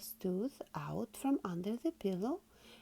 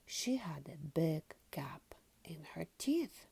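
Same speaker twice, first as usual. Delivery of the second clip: usual speaking voice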